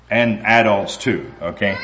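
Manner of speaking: usual speaking voice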